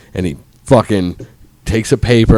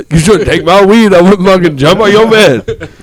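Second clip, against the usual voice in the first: high, loud joking retort; hammed-up protest; mock defiant comeback; 10 semitones higher, 6 dB louder than usual. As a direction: throw it back in playful indignation. Acted comeback to the angry father.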